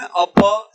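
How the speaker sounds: अप as a loud a-pa; full final vowel in अप. Shown as a demonstration. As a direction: stress the open प ending अप. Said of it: "क्या बोल रहा हूं मैं?". (अप के प का पूरा उच्चारण)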